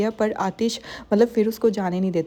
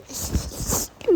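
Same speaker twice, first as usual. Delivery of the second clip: soft breathy laugh; 3 dB quieter than usual. Real, not acted. Amused at the bath scene.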